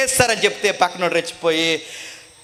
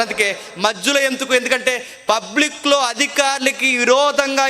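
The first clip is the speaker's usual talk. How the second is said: raised, driving preacher voice; impassioned preaching; 7 semitones higher than usual. Acted. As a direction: press the point hard to the crowd. Preaching at full force. (ఉద్రేకపూరిత ప్రసంగం)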